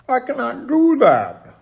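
slow, high, loud protest; shouting. A narrator's reading. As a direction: wail it stubbornly and loudly. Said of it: "shouted Toad".

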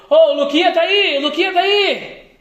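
imitated high, loud call-out; retold shout. Guard calling into the bakery for Luquinha, retold shout. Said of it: "Aí ele gritou".

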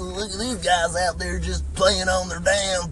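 put-on slow, high grumbling old man; old timer. Sitting at dinner griping at phone-addicted couples, as a slow cranky old man. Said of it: "like an old timer".

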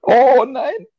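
high startled oh no; sudden alarmed outburst; shocked cry. Real, not acted. Recording plays at wrong spot.

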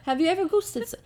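high, wobbly mock British; attempted British accent. Shown as a demonstration. Failing at Irish for a friend, high British attempt. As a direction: try to sound British. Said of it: "I'm trying to be British".

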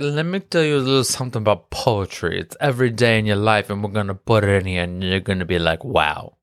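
fast, mock grand studio pitch; mock Marvel voice. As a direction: sell it like a smug studio. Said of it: "Marvel said".

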